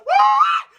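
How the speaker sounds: high, loud preacher's whoop; ecstatic woo. Real, not acted. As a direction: whoop it out in fervor.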